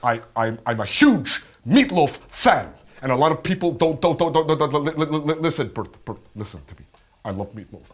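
halting, stammering actor, sudden emphatic bursts; Adam Driver. Acted. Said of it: "Do your Adam Driver".

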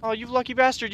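high, loud disbelief; loud jealous surprise; surprised outburst. Real, not acted. Teammate finished quest first.

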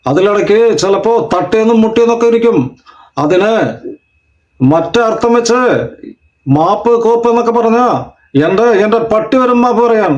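high-pitched film actor impression; Suresh Krishna. Shown as a demonstration. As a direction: speak high, phrase by phrase, with held endings. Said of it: "അടുത്തതായി സുരേഷ് കൃഷ്ണ".

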